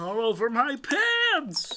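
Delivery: high, slowly drawn-out echo; mock echo. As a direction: tease him by stretching it. Mocking echo of spill.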